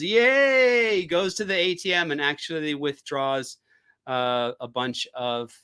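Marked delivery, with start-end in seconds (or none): 0.0-1.0 high squealed yay like a soundboard; playful fake crowd yay; mock applause cheer. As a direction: cheer it like a canned applause clip. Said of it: "If I had Alice's soundboard, I would do the applause".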